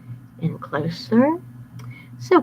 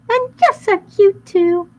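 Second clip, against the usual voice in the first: very high cute voice; cutesy; 13 semitones higher, 7 dB louder, slower than usual. A narrator's reading. Narrator mocking cute disguise, high squeak. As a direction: squeak it sweetly.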